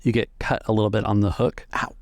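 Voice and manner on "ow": usual speaking voice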